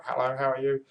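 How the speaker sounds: high squawky parrot imitation; a high mock parrot voice. Shown as a demonstration. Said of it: "Think about a parrot".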